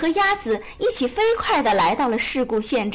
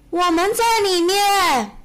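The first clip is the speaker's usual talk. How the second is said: high, drawn-out shout; calling out together; 7 semitones higher, 4 dB louder, slower than usual. A narrator's reading. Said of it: "一起叫起来". (拖长声音高喊)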